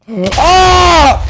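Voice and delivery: high, loud startled cry; startled shriek. Real, not acted. Streamer startled by a lucky block, shriek. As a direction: shriek at the sudden danger.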